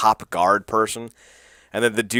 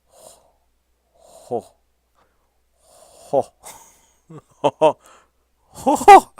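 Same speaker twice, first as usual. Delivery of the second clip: from faint to much higher and louder hooting; hoot, rising into a delighted howl; excited ho-ho climbing high and loud; building mock hoots; 8 semitones higher, 9 dB louder, much slower than usual. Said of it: "And I'm like".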